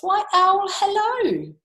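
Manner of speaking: very high sing-song hello; high, bold storybook greeting; greeting mouse. Acted. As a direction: hail the owl in a piping voice.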